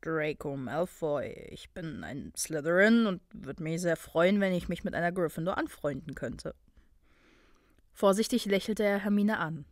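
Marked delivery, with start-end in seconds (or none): 0.0-6.6 put-on low, soft boy's character voice; low-voiced, tentative self-introduction; Draco, cautiously friendly. A narrator's reading. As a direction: introduce yourself carefully, pitched well down.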